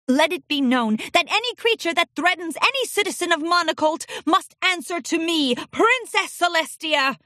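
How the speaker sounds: a touch of a royal bellow. Acted. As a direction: proclaim it to the hall. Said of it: "she bellowed".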